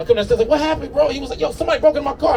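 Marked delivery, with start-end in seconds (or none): 0.5-1.1 high-pitched quoted question to a friend; acting out a concerned bro; retold urgent exchange. Acted exchange with upset friend. 1.4-2.4 high, worked-up quoted complaint; acting out an indignant car owner; retold mad friend. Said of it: "he gets mad".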